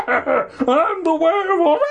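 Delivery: high shriek broken by laughter; laughing manic shriek. Acted. Hermit chasing the kids, laughing high shriek. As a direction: shriek it through a cackle.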